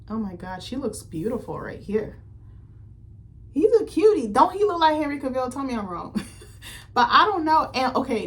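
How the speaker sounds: ordinary talk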